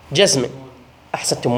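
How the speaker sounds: usual speaking voice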